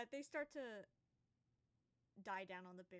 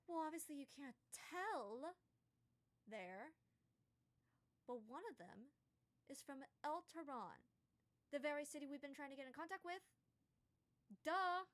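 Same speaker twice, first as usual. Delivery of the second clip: higher, louder scolding that builds to a loud Duh; exasperated genasi; 6 semitones higher, 6 dB louder than usual. Acted. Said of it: "him and just goes".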